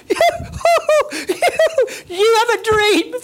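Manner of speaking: high, loud, laughing jeer; squeaky ridiculing voice; mocking crowd. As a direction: sneer through laughter at the dreamer.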